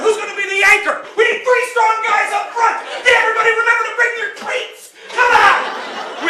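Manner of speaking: rally the team before the big pull; fired-up team shout. Retold or imitated. Kids hyping tug of war.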